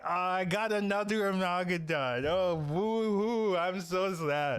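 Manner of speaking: high, slow, drawn-out fake wail; hammed-up boo-hoo over bad luck; mock crying. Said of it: "he's crying".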